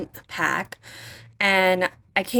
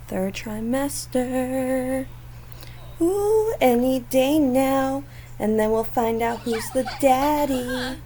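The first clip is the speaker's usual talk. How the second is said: teasing voice; 6 semitones higher, slower than usual. Pretend. Teasing the pregnant Sim.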